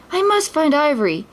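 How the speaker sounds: high, urgent resolve; determined exclaim. A narrator's reading. Aladdin vows to rescue the stolen camel, urgently. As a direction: say it like a vow. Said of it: "Aladdin exclaimed".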